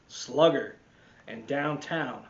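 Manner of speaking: usual speaking voice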